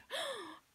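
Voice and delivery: sharp high gasp of horror; shocked gasp. Acted. Mother gasps at the grass stains, sharp and high. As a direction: gasp as if stung. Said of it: "He heard his mother gasp".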